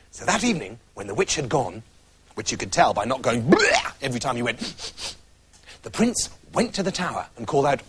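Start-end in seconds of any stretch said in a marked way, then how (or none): 3.4-3.9 a high, silly bleh; a child's high raspberry bleh; mock disgusted bleh. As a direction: pull a face and blurt it. Mimicking the witch's noise.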